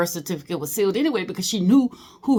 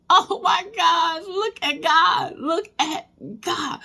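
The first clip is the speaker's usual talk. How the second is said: much higher, slow, louder stunned praise; overwhelmed joy; 11 semitones higher, 3 dB louder, slower than usual. Real, not acted. Discovering a living cousin, real overwhelmed praise. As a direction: overcome with joy at the news.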